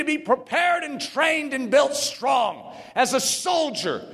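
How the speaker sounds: high, shouted preaching; preaching at full force. Acted. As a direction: preach it with fire, near shouting. Preaching climax on Jesus's patience.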